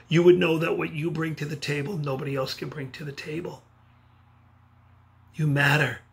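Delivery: ordinary talk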